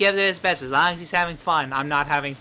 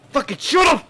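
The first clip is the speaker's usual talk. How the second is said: loud mock yell; shouted; 9 semitones higher, 6 dB louder than usual. Mocking shout at himself.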